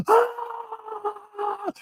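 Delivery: high mock scream of pain; a short high wail from outside; imitated distant cry. Stranger's cry heard through the door, high imitated scream. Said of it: "Like, screaming".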